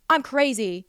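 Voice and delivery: ordinary talk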